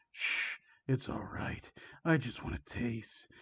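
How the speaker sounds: slow, hushed menace; demonic voice. Acted. Villain shushes screaming victim, demonic whisper. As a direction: whisper it slowly, creepily soothing. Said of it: "His already demonic voice scaring".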